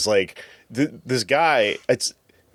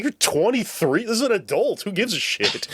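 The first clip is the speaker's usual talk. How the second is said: pitched-up, mocking disbelief; much higher, baffled at his age; incredulous; 11 semitones higher than usual.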